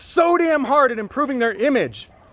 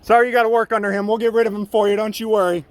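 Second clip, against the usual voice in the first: ordinary talk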